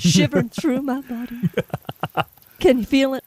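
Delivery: lightly voiced Southern preacher. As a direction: ham up the preacher's fervour, a touch. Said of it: "a Southern preacher voice instead".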